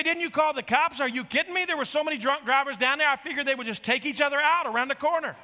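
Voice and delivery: high, loud comic protest; exasperated disbelief. Real, not acted. Preacher answering why he didn't call cops, loud disbelief.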